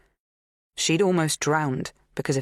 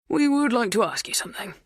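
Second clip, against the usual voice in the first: higher-pitched, courteous request; polite; 8 semitones higher than usual. A narrator's reading. Neighbour with a question for a farmhand, polite. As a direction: ask very politely, as a visitor would. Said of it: "Bernard said politely".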